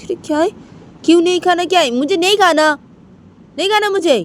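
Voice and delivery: yelling he won't eat; furious son. Acted. Son shouting refusal. (गुस्से में चिल्लाता बेटा, बहुत ऊँची आवाज़)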